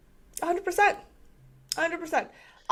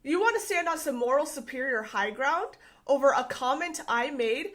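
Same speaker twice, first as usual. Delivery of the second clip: usual speaking voice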